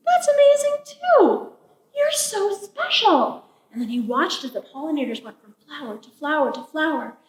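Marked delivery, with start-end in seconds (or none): 0.1-3.4 high, slow admiring praise; gushing storybook amazement; delighted awe. A narrator's reading. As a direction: stretch the words in wonder. Raven praises the wasps.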